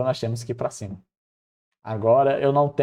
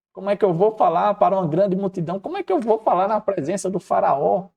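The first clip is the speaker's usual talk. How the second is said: higher-pitched, mildly hammed-up pleading; acted-out objections in a raised pitch; anxious protest; 7 semitones higher, 3 dB louder than usual. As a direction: protest your own shortcomings as a worried Moses. Acting out Moses' anxious objections.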